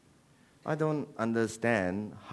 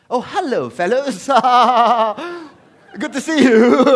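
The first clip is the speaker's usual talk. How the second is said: high, loud British greeting with laughs; mock polite British; 10 semitones higher, 11 dB louder than usual. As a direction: hail them as a jolly British soldier. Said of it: "some polite British war?".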